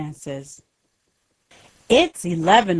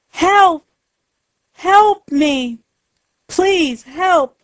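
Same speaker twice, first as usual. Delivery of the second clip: screaming for help; 9 semitones higher, slower than usual. Acted. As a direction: call out desperately for help from afar. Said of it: "There is a scream".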